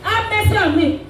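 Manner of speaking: high-pitched insistent cry, half shouted; retold defiant shout. Blind man refusing to listen to the crowd, retold shout. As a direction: call out over people trying to silence you. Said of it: "He shouted".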